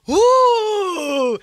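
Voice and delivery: high, loud crowd whoop. Retold or imitated. Crowd on the beach whooping at the slap. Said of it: "she heard".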